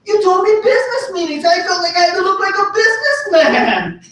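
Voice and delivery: very high, hammed-up self-defence; mock protest. Teenager defending his suit to friend's mom, high mock protest. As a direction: plead the case in an exaggerated high voice.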